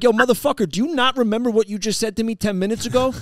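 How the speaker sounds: high, loud angry yell. Retold or imitated. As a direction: yell it at a kid who's mouthing off. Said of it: "I'm yelling at this kid".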